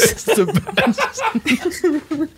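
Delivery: squeaky high laughing brag. Acted. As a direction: giggle the words out, slow and high. Playful boast, laughing.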